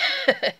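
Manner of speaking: short high giggle at her own slip; a quick embarrassed chuckle; amused laugh. Real, not acted.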